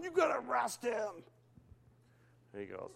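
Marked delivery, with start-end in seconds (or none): 0.0-1.2 higher, shouted plea to arrest; mock demanding kid. Young Roy demanding the trooper arrest the pastor, mock shout. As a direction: yell it like a bratty kid.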